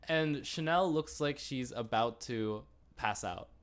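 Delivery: ordinary delivery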